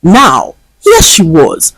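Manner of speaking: plain reading voice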